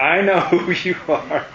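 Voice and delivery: amused laugh between the words; laughing quote. Real, not acted. Teacher delighted, laughing while quoting the unclean spirit. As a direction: break into a laugh mid-quote.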